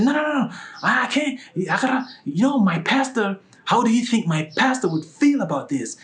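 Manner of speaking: high, loud mock protest; alarmed refusal. Woman worrying what the pastor would think, alarmed refusal.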